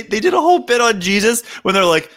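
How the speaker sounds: usual speaking voice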